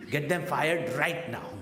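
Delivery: high, loud, slow demand; hammed-up indignant society woman; outraged society lady. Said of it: "society people".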